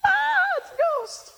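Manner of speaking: very high mock panic; frightened Peter. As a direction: yelp in hammed-up fright from the boat. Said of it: "I imagine Peter sitting here in the boat".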